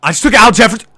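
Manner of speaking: high, loud burst of disbelief; real shocked outcry; horrified shout. Real, not acted. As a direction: yell it in sudden panic. Accidental draft pick, panics.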